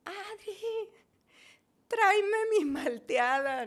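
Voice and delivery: calling down from upstairs, drawn out; retold shout. Patient calls to sister from balcony, retold shout. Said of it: "me asomo y le grito".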